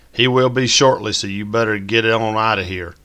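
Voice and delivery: wife teasing in a put-on Southern drawl; fake southern drawl. A narrator's reading. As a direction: play along in a hammed-up Southern accent. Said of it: "with a fake southern drawl".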